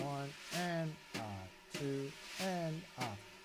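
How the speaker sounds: slow, fading triplet count; slow counting aloud. Shown as a demonstration. Teacher counts triplets slowly during demonstration.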